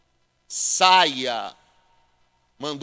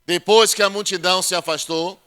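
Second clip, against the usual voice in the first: loud, higher proclamation of Jesus' command; full-force preaching; 3 semitones higher, 7 dB louder than usual. Acted. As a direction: proclaim it to the congregation.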